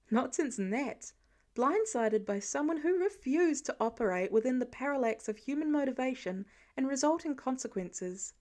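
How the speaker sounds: plain reading voice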